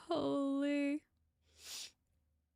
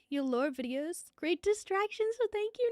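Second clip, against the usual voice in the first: bright reading; 6 semitones higher than usual. Pretend. Reading thanks from chat.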